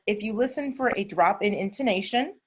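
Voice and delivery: ordinary talk, nothing marked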